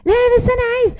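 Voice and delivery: put-on a child arguing back with the parent, high and insistent; nagging child. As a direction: plead like a stubborn kid. Child pleads in acted quarrel.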